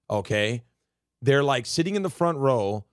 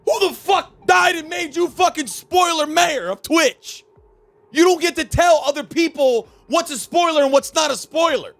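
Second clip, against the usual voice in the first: much higher and louder furious shouting; high loud furious rant; angry rant; 11 semitones higher, 5 dB louder than usual. Real, not acted. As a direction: yell them down in outrage. Ranting at spoiler defenders.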